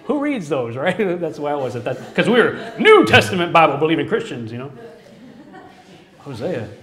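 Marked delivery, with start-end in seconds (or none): 2.1-4.5 louder, mock-proud; wry emphasis. Real, not acted. The speaker jokes about his New Testament church, wry and loud. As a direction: play up the label with a grin.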